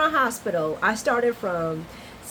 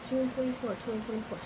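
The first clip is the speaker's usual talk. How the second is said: much softer aside; hushed repeat; 2 semitones higher, 9 dB quieter, faster than usual. Real, not acted. Repeating the date to herself in surprise, hushed. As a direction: say it quietly, as if to yourself.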